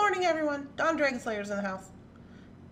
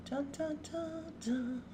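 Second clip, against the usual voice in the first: soft, high sung filler syllables; idle dun-dun noises; 6 semitones higher, 8 dB quieter, slower than usual. Real, not acted. Streamer filling time between greetings, soft dun-duns. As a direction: softly sound out a little dun-dun.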